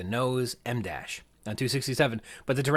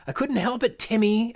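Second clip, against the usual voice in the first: high-pitched, louder exclamation; mock kid's yell; 11 semitones higher, 4 dB louder than usual. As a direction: cry it out in a high, protesting voice. Kids screaming in quoted story.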